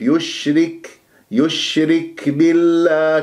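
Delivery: slow recited يشرك with a delicate breath on k; gentle hams. Shown as a demonstration. Teacher repeating the model, gently breathed kaf. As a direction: release the k of يشرك lightly, then hold بالله. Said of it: "همس لطيف".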